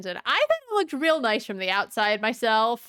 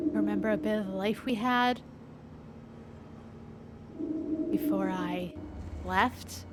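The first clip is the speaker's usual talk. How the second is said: ghostly half whisper; 2 semitones lower, 9 dB quieter than usual. Acted. The mother's ghost speaks softly to her son in a near whisper. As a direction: whisper it like a voice from beyond. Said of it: "like, somewhat of a whisper".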